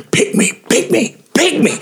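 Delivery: imitated conductor, strained and very high; irate muttered insult. Conductor at the next rehearsal insulting the pianist, irate. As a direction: hiss the insult, pitch shooting up. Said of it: "same thing".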